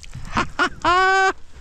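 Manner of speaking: real high-pitched burst of laughter; delighted laugh. Real, not acted. Angler laughing as the trout hits the net, delighted laugh.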